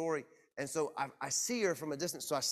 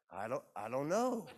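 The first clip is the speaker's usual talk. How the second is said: retold uncertainty. Retold unsure answer to wife.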